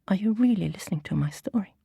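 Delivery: usual speaking voice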